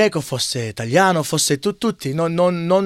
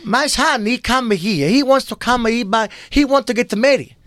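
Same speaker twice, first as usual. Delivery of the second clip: put-on high-pitched immigrant mother with thick accent; Giuseppina, the mamma; 10 semitones higher than usual. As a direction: play an old-country mamma, pitched way up. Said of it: "That's Giuseppina". (voce acuta da mamma immigrata, accento forte)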